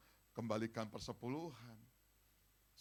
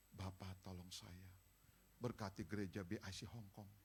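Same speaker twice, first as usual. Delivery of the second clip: soft, lower pleading prayer voice; a meek whispered-like prayer for money; quiet humble plea; 4 semitones lower, 7 dB quieter than usual. Shown as a demonstration. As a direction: beg God humbly and softly. Acts out the right prayer.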